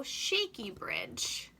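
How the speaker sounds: ordinary delivery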